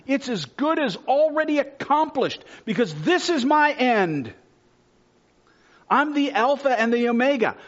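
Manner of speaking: loud, high fervent sermon delivery; preaching at full force. Acted. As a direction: proclaim it to the whole church.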